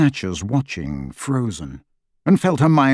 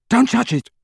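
sharp yell, much higher in pitch; 11 semitones higher, 3 dB louder than usual. Acted. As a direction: cry out in pain and panic. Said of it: "Harry yelled".

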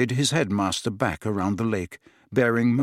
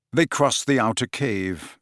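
ordinary delivery, nothing marked

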